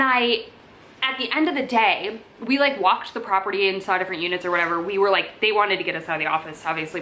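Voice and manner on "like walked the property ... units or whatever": ordinary delivery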